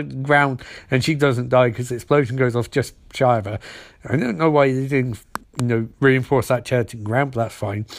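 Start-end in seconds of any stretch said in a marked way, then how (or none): none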